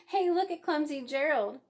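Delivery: mocking call across the dance floor; sneering. A narrator's reading. Jungle animals jeer at clumsy Gerald, loud sneer. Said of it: "the animals all sneered".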